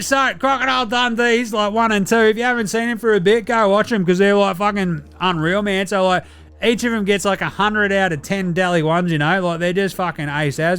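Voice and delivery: rowdy Olympian mate sending in a voice report; Delly character voice. Acted. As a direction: play a cheeky Aussie athlete phoning in from Paris. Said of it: "very special report from Delly".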